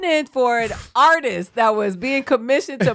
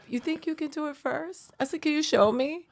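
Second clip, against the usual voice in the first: much softer challenge; 3 semitones higher, 9 dB quieter than usual. Retold or imitated. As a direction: calmly call their bluff. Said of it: "I was like".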